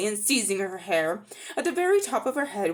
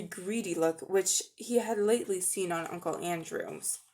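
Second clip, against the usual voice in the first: plain reading voice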